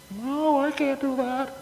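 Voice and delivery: retold self in a high squeak; high, timid refusal; high squeaky protest. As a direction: squeak it out like a nervous kid. Scared refusal to the elders.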